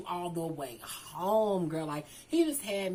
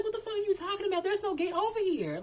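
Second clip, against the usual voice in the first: high, fast, louder exasperated outburst; 12 semitones higher, 3 dB louder, faster than usual. Retold or imitated. Telling the friend there is no gate, high fast exasperation. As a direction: fire it off high and quick. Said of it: "I'm like".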